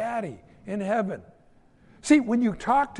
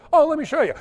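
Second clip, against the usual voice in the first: put-on a higher, louder kid showing off; eager kid voice; 8 semitones higher, 5 dB louder, much faster than usual. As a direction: chirp it like a proud little boy. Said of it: "He goes".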